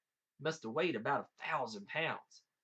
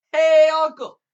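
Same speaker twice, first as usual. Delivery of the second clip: long, high, full-voiced holler; 13 semitones higher, 22 dB louder, slower than usual. A narrator's reading. Jack hollering to his uncle in Virginia, hollering. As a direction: call out to someone miles away. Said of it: "put his hand up to his mouth and hollers".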